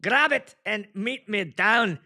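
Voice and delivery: slow, high mock Scottish brogue; rally the clan in a Scottish accent; hammed-up drawn-out Scottish accent. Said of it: "Are any Scottish here in the house?".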